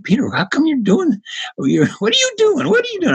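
high-pitched baffled questioning; mock baffled colleagues. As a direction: ape the puzzled coworkers pestering him. Colleagues quizzing him, mocked.

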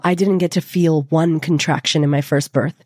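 ordinary talk, nothing marked

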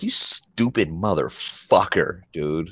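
slow, each syllable of "motherfucker" spelled out crisply; over-enunciate "You stupid motherfucker" syllable by syllable; every syllable enunciated. Retold or imitated. Friend retelling a comeback, enunciating every syllable. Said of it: "You put every syllable in that bitch".

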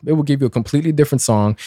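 ordinary talk, nothing marked

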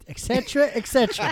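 high airy et cetera; mock official. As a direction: wave it off like a pompous official.

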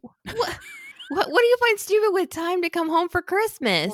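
shocked high-pitched disbelief; incredulous. Real, not acted. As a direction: react like you can't believe it. Indignant reaction to "stupid" lyrics.

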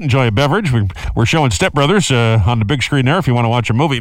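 loud, calm airline captain announcement; pilot cockpit headset. Pretend. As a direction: talk like a captain on the intercom. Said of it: "captain didn't notice".

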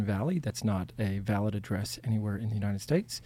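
a hint of a bureaucrat squeezing money; IRS agent. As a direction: read the infractions like a smug tax official, quietly. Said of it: "being an I R S agent".

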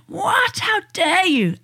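high-pitched mock outrage; outraged. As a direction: act out an affronted parent, voice shooting up. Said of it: "when they're angry and expressing their anger".

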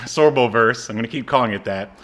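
ordinary talk

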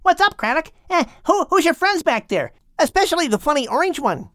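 put-on high, loud, bouncy kid voice, fast at the end; a cheeky bundle of energy; playful young dolphin. The young dolphin quizzes the whale, bouncy and cheeky.

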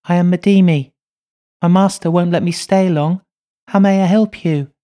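put-on high, loud little girl voice; a spirit child speaking through a medium; little girl's voice. A narrator's reading. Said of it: "speaks in a little girl's voice".

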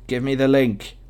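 usual speaking voice

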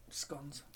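"Scones" rhyming with "gones", said softly; short-o scones. Shown as a demonstration. Co-host answers which way to say "Scones", short o as in "gone". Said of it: "'Cause when it's gone".

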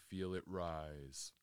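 measured, settled delivery, letting each phrase hang in silence; guided meditation. Real, not acted. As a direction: guide listeners calmly inward, phrase by unhurried phrase.

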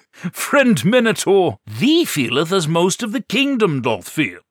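put-on high, loud, old-fashioned knight; theatrical knight, laughing at first; hammy knight voice.

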